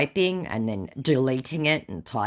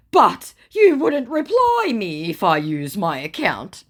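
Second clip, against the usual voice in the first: higher, louder put-on scammer voice; mock scammer voice; 7 semitones higher, 7 dB louder, slower than usual. Scammer excusing his fake account, high mock voice. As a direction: whine the old excuse. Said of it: "Pulling that old bullshit's card out".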